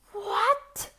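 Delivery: terrified cry. A narrator's reading. Timid giant crying out at the tailor's boast. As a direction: squeak it out in fright. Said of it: "cried the timid giant in great terror".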